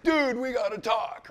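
quoting a friend who urgently wants to talk; urgent plea. Retold or imitated. Friend urgently calling him.

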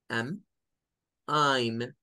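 am flat, then I'm with an ai glide. Shown as a demonstration. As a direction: contrast am and I'm clearly. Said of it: "Noten la diferencia".

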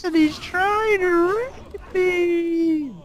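put-on high cute anime girl. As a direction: squeal it like a panicked cartoon girl. Mock panicked call for help.

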